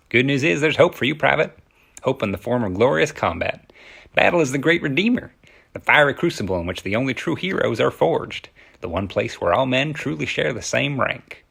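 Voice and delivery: forceful movie speech in an Appalachian-tinged Kentucky accent. Shown as a demonstration. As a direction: declaim it in a Kentucky accent, R's hit hard. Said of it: "knowing th-, those few facts about a Kentucky accent, let's try".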